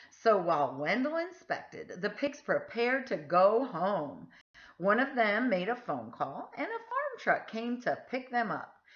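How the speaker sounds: ordinary delivery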